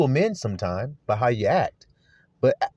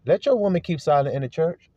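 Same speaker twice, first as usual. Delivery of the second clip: usual speaking voice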